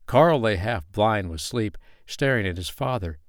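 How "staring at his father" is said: ordinary delivery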